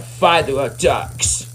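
normal talking voice

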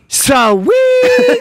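high, loud squeal of delight; excited shout. Pretend. Comic squealing to show how he'll short circuit. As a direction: yelp it out like a broken toy. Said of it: "And I'll just go".

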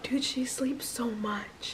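whisper. Real, not acted. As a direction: drop to a breath. Whispering beside the sleeping baby.

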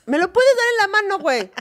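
reclamo agudo, medio riendo; indignación actuada. Acted. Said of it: "Me caga". (fastidio exagerado)

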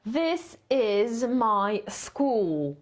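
very slow, every word drawn out for copying; slow model reading. Shown as a demonstration. Teacher reading slowly for students to copy, very slow. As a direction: read it slowly so students can write. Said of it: "a ja czytam".

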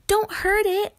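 high, loud urgent plea; exclaiming. A narrator's reading. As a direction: cry out to protect the mouse. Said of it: "Lainey exclaimed".

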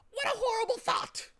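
very high, loud, horrified squawk; shuddering bird. A narrator's reading. Bird shuddering at going back, high horrified squawk. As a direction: recoil in horror as a bird. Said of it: "the bird shuddered".

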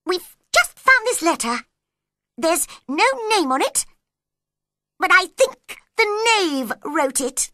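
high, loud urgent rabbit voice. A narrator's reading. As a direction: burst in with news in a high animal voice. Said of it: "jumping up in a great hurry".

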